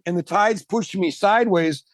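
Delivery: ordinary talk, nothing marked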